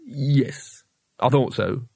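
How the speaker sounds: understated sour resignation; bitter. A narrator's reading. Rincewind resigned to fetching the onions, mildly bitter. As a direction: say it with a touch of bitterness. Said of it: "said Rincewind bitterly".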